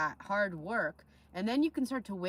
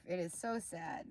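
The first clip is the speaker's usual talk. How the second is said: ordinary talk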